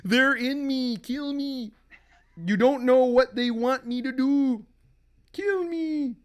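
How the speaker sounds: high anguished begging, loud in bursts; possessed husband. Acted. Husband begs wife to end it, high torment. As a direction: plead as a man tormented from inside.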